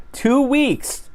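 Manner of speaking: Two pitched very high and stretched; emphatic. Real, not acted. Vlogger stressing planning time, emphatic high Two. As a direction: hammer home how long it took. Stressing how long it took.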